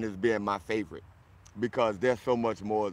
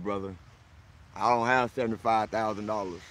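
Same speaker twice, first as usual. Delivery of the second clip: ordinary talk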